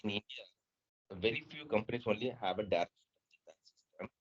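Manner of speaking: very faint, far-off voice; speaking much too softly; barely audible. Real, not acted. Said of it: "Your voice is very low".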